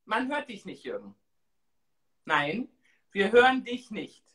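normal talking voice

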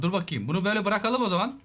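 ordinary talk

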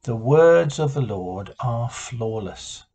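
slow, deliberate reading of a meditation line; reflective sentence. Shown as a demonstration. Leader giving the congregation a sentence to ponder, slow and deliberate. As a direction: let each word sit for reflection. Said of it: "and the sentence is".

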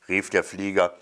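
ordinary delivery, nothing marked

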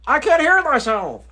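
"I can't hear myself" called out loud and high, "hear" punched. Real, not acted. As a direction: shout "hear" in frustration. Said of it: "you raising your voice".

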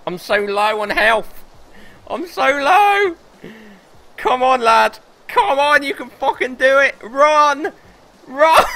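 high, loud, strained urging; frantic cheering. Real, not acted. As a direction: scream yourself across the line. Panicked cheering self on.

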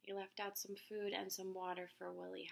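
ordinary delivery, nothing marked

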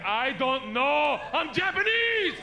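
slow, high, loud retort; mock exasperated yell. Imitating a stranger fed up with being asked, slow yelling. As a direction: spell it out loudly in exasperation.

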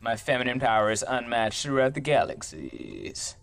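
ordinary delivery

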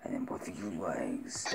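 soft, whispered monster voice; Venom. Shown as a demonstration. Man practising a film villain's line, whispered monster impression. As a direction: whisper it like a creature sizing up organs. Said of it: "I got his voice down".

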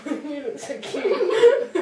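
high giggly words through laughter; laughing. Real, not acted. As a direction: laugh while you say it. Laughing at friend's stuffed face.

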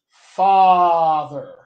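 "father." loud, with a long "ah" vowel; open back unrounded. Shown as a demonstration. Coach giving the example word, loud open "ah" in "father.". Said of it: "it appears in the word".

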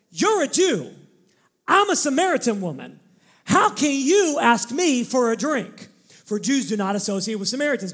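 loud, sassy Italian attitude; arguing for sport, sassy and loud. Shown as a demonstration. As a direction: read it with sass like you'd argue. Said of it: "I hear it like I would say it".

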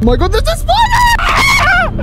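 very high, loud, drawn-out scream; terrified shriek. Real, not acted. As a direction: scream it in panic, stretching it out. Shouted spider scare.

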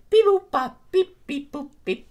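beeping noises. Acted. Pressing buttons.

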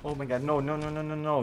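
real dismayed cry; desperate protest. Real, not acted. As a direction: beg it not to happen. Losing the fight, protests.

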